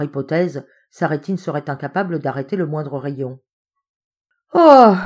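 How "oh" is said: high, loud falling moan. A narrator's reading. Padpinton hearing his theory demolished, loud moan. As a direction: let out a big dismayed groan. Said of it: "Gémit monsieur Padpinton".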